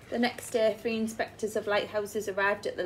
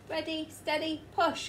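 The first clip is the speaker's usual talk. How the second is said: higher rallying call; 8 semitones higher than usual. Acted. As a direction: count the crowd in to push. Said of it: "called Mister Grinling".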